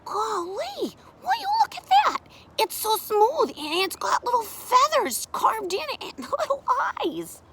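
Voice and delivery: put-on very high, wonder-struck kid voice; amazed child. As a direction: marvel with a giggle of delight. Child marvels at carved bird.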